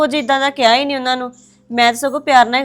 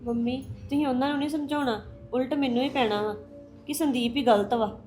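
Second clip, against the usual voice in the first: much softer, choked-up words; almost too upset to speak; daughter-in-law weeping to her mother-in-law, very soft; tearful and hushed; 11 dB quieter than usual. Acted. Crying daughter-in-law complains.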